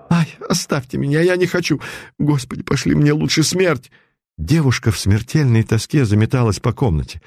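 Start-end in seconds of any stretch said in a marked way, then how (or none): 0.1-3.9 terrified plea. Acted. Girl begging in terror.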